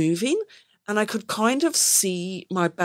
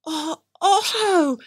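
delighted mum; 7 semitones higher, 5 dB louder than usual. Pretend. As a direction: greet the toddler like mum is overjoyed. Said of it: "you go".

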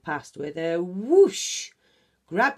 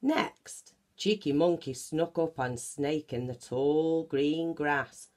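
usual speaking voice

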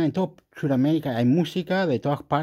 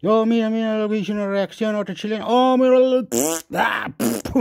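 mocking a Spaniard; 6 semitones higher than usual. Mocking a Spanish YouTuber's reaction, high and gushing. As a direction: ham up an over-excited Spanish reactor. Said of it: "hablar con un español que está diciendo".

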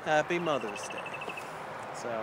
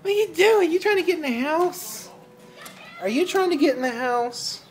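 high pet voice; 7 semitones higher than usual. Real, not acted. Baby talk to the dog.

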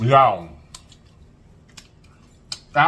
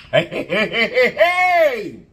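excited cheer; 6 semitones higher, 4 dB louder, slower than usual. Real, not acted.

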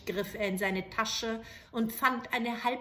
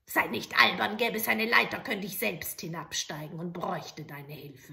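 put-on louder, impatient old hag; scolding witch; 2 semitones lower, 4 dB louder than usual. Witch scolding the soldier about the ladder. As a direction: scold him like a fool.

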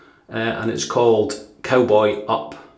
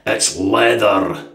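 slow, heavy Scottish "It's leather" with a rolled r; broad Scottish accent; much slower than usual. Shown as a demonstration. Shaving vlogger showing off his own Scottish voice, broad Scottish accent. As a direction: lean right into the Scottish accent. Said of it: "I'll say it in my Scottish".

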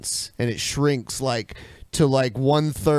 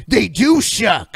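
mocking quote; 7 semitones higher, 5 dB louder, slower than usual. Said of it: "Before it was like".

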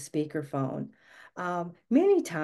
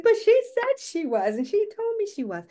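much higher, pleading and stunned; same Laura Petrie wail; 5 dB louder than usual. Retold or imitated. Wife insisting to husband, high wounded plea. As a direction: protest like a wounded sitcom wife. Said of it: "And I was like".

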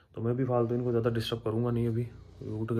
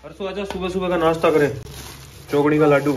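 bright, louder, higher invitation; cheery and loud; 5 semitones higher, 10 dB louder than usual. Real, not acted. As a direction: call out happily over breakfast. Cheerful tea-time banter with child.

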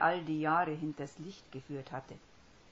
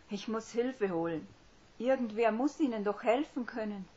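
higher and louder, anxious rush; unsure, frightened; 6 semitones higher, 7 dB louder than usual. Acted. Hermine deciding to fetch help, frightened. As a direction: sound scared and unsure. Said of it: "So unsicher hatte er ihre Stimme noch nie gehört".

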